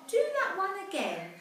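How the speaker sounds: high, loud eager call; class cheering. Acted. Classmates begging Cassie to repeat the spell, excited. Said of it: "the whole class would start to cry".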